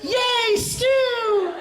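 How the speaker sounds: put-on high, squealed childlike cheer; long, gleeful high-pitched cry. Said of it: "Al turns around and goes".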